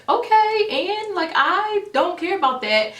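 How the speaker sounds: mock-annoyed brush-off, pitched high; high, brushing-off retold annoyance. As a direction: dismiss her, irritated. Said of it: "I'm kind of annoyed".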